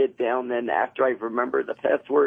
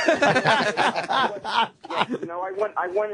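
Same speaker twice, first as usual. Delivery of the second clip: higher laughing yeah; 8 semitones higher, much slower than usual. Real, not acted. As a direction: laugh it out, giddy. Laughs admitting he was nude.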